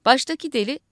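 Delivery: usual speaking voice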